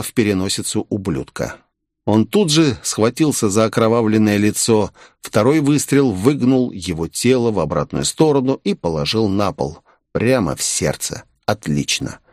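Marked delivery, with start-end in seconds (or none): none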